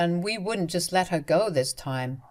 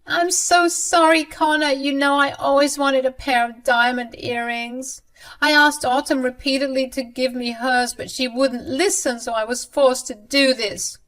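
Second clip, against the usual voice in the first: whining mother-in-law; 7 semitones higher, 4 dB louder than usual. Acted. Mother-in-law excusing herself to her son, high and whining. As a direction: whine an excuse.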